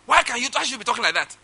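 high-pitched indignant protest; acted-out outrage on the phone; retold temper. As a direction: lose your temper at the caller, pitch jumping up. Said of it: "I just lost my temper".

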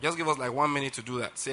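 normal talking voice

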